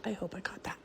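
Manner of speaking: hushed, scared whisper; frightened whisper. Real, not acted. Mother filming a haunted house, whispering in fear. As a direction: whisper so the noise isn't drowned out.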